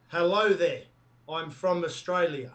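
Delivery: natural-pitched, nearly right Australian accent; convincing Aussie accent. Shown as a demonstration. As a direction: do a believable Australian accent in an easy register. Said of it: "That's pretty close".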